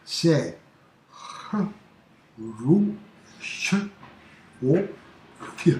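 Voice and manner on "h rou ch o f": H, Rou, Ch, O, F said one by one with pauses; spelled out. Shown as a demonstration. Teacher breaking the name into sounds. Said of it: "la prononciation détaillée, décortiquée".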